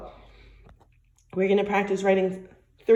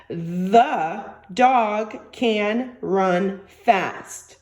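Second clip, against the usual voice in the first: each word spaced out, very slow; very slow dictation; much slower than usual. Shown as a demonstration. Teacher to young students, dictating the sentence very slowly. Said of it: "I'm gonna say the sentences really, really slow".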